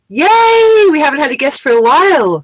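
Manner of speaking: high, bright-pitched cheer over the guest; excited yay. Real, not acted. Host cheering to co-host about a guest, excited high squeal. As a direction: squeal with delight at having a guest.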